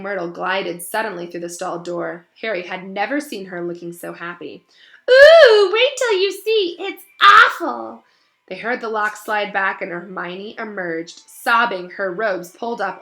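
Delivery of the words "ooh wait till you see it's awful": very high, loud, slow ghostly delight; gleeful ghost. Acted. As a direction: savour the bad news like a gloating ghost.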